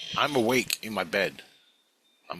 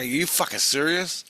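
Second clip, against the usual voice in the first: incredulous; 7 semitones higher than usual. Retold or imitated. Acted disbelief at quest angel story.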